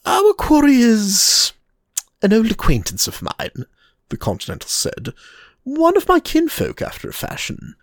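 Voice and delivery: the Continental teasing William, sly and high; Reinhardt voice. Acted. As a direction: purr it like a sly Continental lord.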